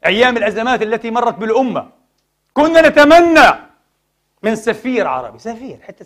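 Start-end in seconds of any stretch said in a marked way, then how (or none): 2.5-3.5 loud, high exclamation; impassioned cry. Real, not acted. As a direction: cry out the frustrated wish. Preacher laments officials never resigned.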